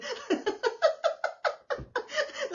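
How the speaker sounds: long high giggling laugh. Real, not acted. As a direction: keep laughing, breathy and light. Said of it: "Tawa ng tawa si Ninang". (mataas na tawa)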